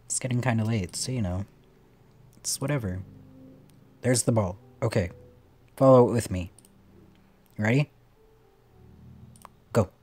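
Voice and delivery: ordinary delivery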